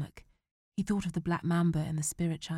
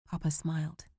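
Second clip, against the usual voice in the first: ordinary delivery, nothing marked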